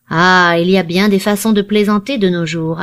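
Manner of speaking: usual speaking voice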